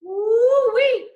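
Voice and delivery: high, loud woo-wee; excited whoop. Real, not acted. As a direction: whoop at the strong smell. Reacting to a strong scent.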